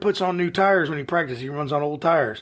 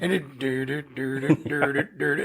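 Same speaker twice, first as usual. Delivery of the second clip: engine noise; 3 semitones lower, 3 dB quieter than usual. Retold or imitated. Imitating car engine passing.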